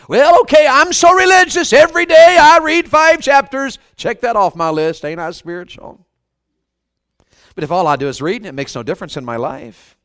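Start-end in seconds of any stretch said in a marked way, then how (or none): 0.1-6.1 self-satisfied religious person. Acted. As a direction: brag like a proudly religious churchgoer. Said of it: "we just be religious".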